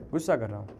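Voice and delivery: low, soft and subdued; hushed, low-pitched murmur; quiet questioning. Real, not acted. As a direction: say it softly to yourself. Repeats the question to himself.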